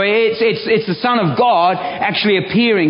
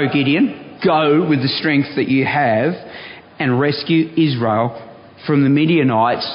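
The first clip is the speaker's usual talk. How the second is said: measured, lower Bible-reading voice; scripture reading; 4 semitones lower than usual. Acted. Preacher reading Gideon's story to the church, measured and lower. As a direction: read the passage steadily to the congregation. Reads the Gideon passage aloud.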